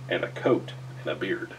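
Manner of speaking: plain reading voice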